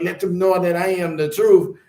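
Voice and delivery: Miss Cleo impression. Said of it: "My Jamaican accent is horrible".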